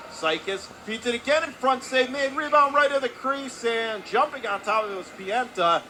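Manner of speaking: high, loud goalmouth play-by-play; excited save call. Real, not acted. Live call of save and rebound.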